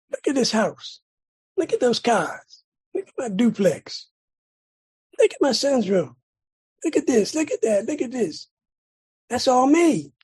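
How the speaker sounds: imitated cocky self-credit; boastful. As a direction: show off each thing in turn, punching the last. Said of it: "I'm taking credit for everything".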